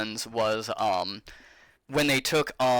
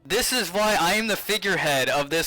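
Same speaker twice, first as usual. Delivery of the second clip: huffy, playful self-importance; mock indignant; 5 semitones higher than usual. Real, not acted. Mock indignant lecture to chat.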